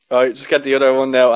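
usual speaking voice

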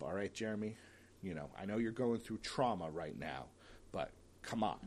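ordinary talk, nothing marked

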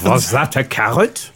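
put-on German accent slipping into French; German accent gone French. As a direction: botch the German so it sounds French. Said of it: "that's French".

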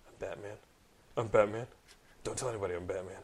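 low, soft secret-identity voice; hushed Batman. Retold or imitated. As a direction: growl it low like Batman confiding. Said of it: "telling somebody his sacred identity".

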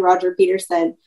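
usual speaking voice

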